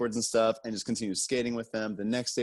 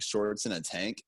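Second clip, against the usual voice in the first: usual speaking voice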